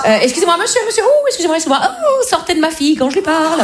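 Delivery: high, flustered mother shooing a man away; indignant mother. Acted. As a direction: interrupt politely, then scold in a high voice. Mother scolds man off her daughter.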